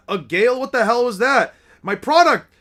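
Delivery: acted shock, rising in pitch; alarmed. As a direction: cry out in alarm over damaged goods. Alarmed stallholders hit by gale.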